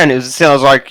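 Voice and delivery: usual speaking voice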